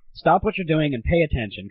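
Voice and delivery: normal talking voice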